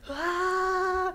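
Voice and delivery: long, high mock yell at ordinary volume; imitated kid's yell. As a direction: imitate a child's drawn-out scream, held high. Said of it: "just yells".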